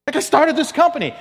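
pleading to be believed; exasperated protest. Retold or imitated. Retold owner's exasperated protest.

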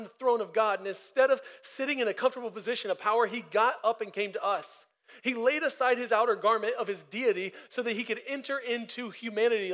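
preacher lifting the room toward the cross; full-force preaching. Acted. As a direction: build the gospel call toward the cross. Sermon climax.

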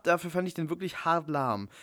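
normal talking voice

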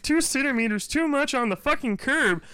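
high, prissy parking officer voice; mock a petty meter maid, smug.

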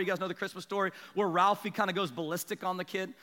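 usual speaking voice

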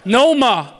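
high, loud, drawn-out "NO-MAH"; over-the-top Boston shout; Boston-accented yell. Acted. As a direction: holler it in broad Boston. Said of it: "keep your distance from the Bostonians".

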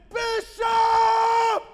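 high, long-drawn shout; top-of-lungs yell. Retold or imitated. Father calling his lost son across the mall, yelling at the top of his lungs. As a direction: yell the name as far as it will carry. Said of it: "I'm yelling at the top of my lungs".

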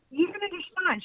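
high, eager pitch throughout; excited insisting. Retold or imitated. Boutique owner insisting to the narrator, high and eager. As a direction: insist with starstruck energy.

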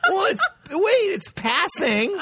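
very high, faltering protest; mock squeaky self-justification; defensive kid. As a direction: plead your case to dad, stretching the last word. Kid pleading case to dad.

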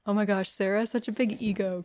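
ordinary talk, nothing marked

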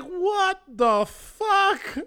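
slow, high, stunned; stunned disbelief. Retold or imitated. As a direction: gape at it, stretching every word. Sees naked Mark on pole.

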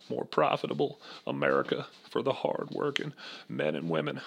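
quiet folksy president speech; Clinton impression.

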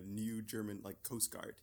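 normal talking voice

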